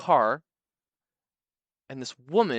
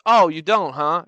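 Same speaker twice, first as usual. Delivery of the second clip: menacing challenge; 3 semitones higher, 9 dB louder than usual. Retold or imitated. Guy pushes back menacingly.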